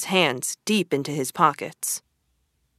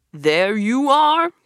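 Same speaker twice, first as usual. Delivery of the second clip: slow, proud cry, louder and higher; cried out; 6 semitones higher, 4 dB louder, slower than usual. Acted. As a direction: unveil the wagon with a flourish. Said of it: "cried Toad".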